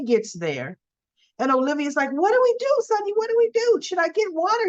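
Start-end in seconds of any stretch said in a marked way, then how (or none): 2.1-4.7 high-pitched frantic questions; mock panic. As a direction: act out a flustered plea for instructions, pitched way up. Acted panicked Olivia at the fire.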